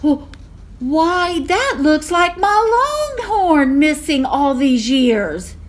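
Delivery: high, loud amazed exclamation; can't believe her eyes; astonished mother. A narrator's reading. Ma recognises her lost longhorn.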